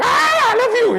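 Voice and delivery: loud high mock shout of love. Shown as a demonstration. Teacher showing the wrong way, shouting the love confession. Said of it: "then you start shouting".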